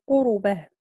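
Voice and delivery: قربه as "qu-ru-bah", u after the r; Warsh's voweled ra. Shown as a demonstration. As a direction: give قربه three syllables with a u on the ra. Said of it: "انفرد الإمام ورش".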